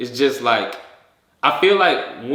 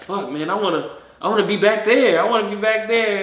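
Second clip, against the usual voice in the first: wistful longing; 7 semitones higher than usual. Acted. Longing to return to life.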